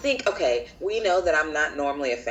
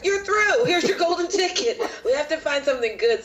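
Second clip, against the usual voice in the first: mock excited judge; 9 semitones higher than usual. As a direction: gush like an eager judge. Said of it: "they were like".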